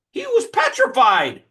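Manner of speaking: loud, high, stretched-out exclamation; emphatic storytelling. Real, not acted. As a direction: punch the climax way up high. Climax of the retold story.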